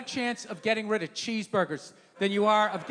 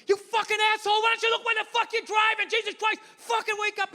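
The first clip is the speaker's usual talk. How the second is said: high-pitched mock yelling at a driver; hammed-up furious tirade, pitched way up; acted angry screaming; 9 semitones higher than usual. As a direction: rant in a raised, high voice. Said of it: "He's screaming at me".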